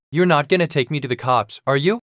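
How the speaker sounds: ordinary delivery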